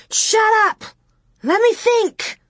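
high-pitched, frantic screech. A narrator's reading. Laura to her screaming sister, screeching. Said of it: "Laura screeched".

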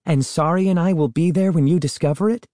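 high, hopeful eager question; eager plea. A narrator's reading. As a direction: beg to come along. Boy begs to join discovery.

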